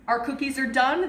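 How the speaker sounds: ordinary talk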